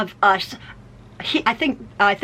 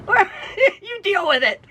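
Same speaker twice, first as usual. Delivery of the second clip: laughing brush-off; 8 semitones higher than usual. Retold or imitated. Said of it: "I said".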